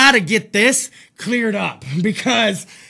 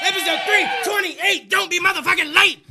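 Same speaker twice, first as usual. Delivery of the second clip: show announcer; 7 semitones higher than usual. Acted. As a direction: sell the episode like a hyped announcer.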